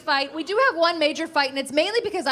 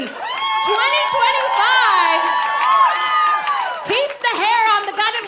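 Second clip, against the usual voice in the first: slow, much higher, louder outraged yell; mock outrage shout; 10 semitones higher, 6 dB louder, slower than usual. Real, not acted. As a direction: shout the year like a protest slogan. Ranting at men about shaving.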